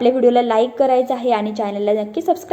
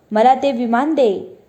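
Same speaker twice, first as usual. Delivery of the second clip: plain reading voice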